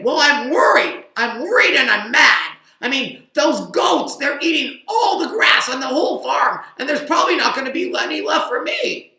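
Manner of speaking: loud high worried shout; loud angry rant about goats; mad pig. Acted. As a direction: shout your worry as Pig.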